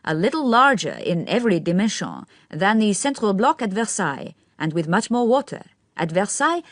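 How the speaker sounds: spoken through a smile, savouring each word; affected young man. A narrator's reading. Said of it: "every syllable received rather more emphasis".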